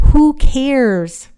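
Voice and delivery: loud, high, scoffing brush-off; mock-exasperated who-cares; dismissive. Real, not acted. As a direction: wave the whole thing away with a scoff. Dismissing her named purse.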